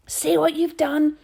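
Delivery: high, loud, slow shout. A narrator's reading. Boy shouting at Dinah after Harvey runs off, loud. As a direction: roar the blame at her. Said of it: "Lloyd shouted at Dinah".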